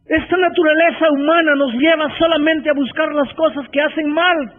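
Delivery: predicando con fuerza y pausas; sermón apasionado, subrayando cada frase; predicación. Acted. (predicación enfática)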